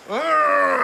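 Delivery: high, loud grunt of pain; pained groan. Retold or imitated. Patient finally getting up after surgery, pained groan. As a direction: heave up with a groan.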